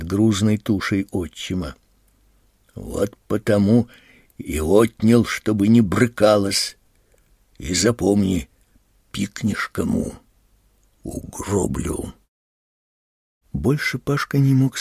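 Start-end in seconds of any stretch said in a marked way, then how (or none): 2.7-12.1 lower, menacing stepfather, sinking at the end; understated threat. A narrator's reading. Stepfather threatens mother in quarrel. (сдержанная угроза, всё ниже к концу)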